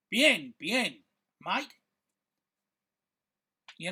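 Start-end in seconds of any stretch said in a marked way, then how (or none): 0.1-1.7 louder mock Aussie "bien, bien"; Spanish words in a light Australian accent. Australian answers his Spanish question, mock Australian accent. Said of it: "knew from the twang".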